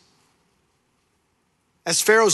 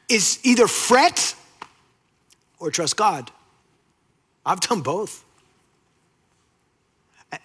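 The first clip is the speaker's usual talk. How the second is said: ordinary delivery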